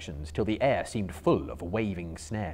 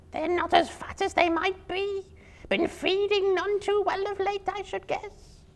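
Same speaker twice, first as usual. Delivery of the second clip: a greedy spider gloating over its catch. Acted. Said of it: "said a third".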